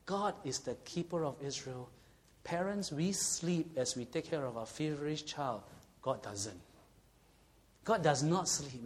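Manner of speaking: ordinary delivery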